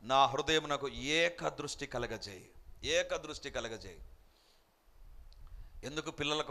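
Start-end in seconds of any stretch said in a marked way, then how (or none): 0.0-4.0 low, slow prayer; prayerful plea. Retold or imitated. The psalmist asks God for a single focus, low and prayerful. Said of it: "అందుకే కీర్తనకారుడు".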